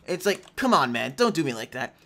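usual speaking voice

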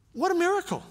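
high, ringing amazement on "what a miracle"; amazed exclamation. Real, not acted. Preacher marveling at the raising of Lazarus, awed. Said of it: "the miracle of all miracles".

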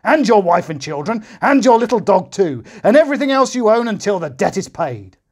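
high, loud, relentless; roaring king. A narrator's reading. The king threatening the servant's family, still roaring. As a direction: keep roaring through the whole list. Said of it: "roared the king".

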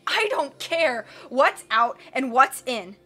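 slow, loud exasperated villain; cried out. A narrator's reading. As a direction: yell in frustration. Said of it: "Count Olaf cried".

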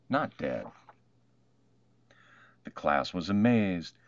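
plain reading voice

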